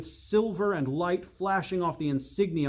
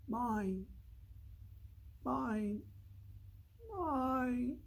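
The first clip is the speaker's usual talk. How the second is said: high, much softer fading whisper of a claim; weaker, fading; 8 semitones higher, 13 dB quieter, much slower than usual. Acted. The defeated enchanter's voice fading away. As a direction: let it drift away, losing strength. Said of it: "it seemed weaker, fading farther away".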